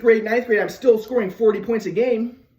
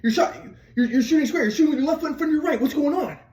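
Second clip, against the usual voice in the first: higher, exasperated dad scolding; mad dad; 6 semitones higher than usual. Retold or imitated. Dad scolding his son in the backyard, exasperated. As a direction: play the fed-up father, voice up high. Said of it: "my dad's mad at me".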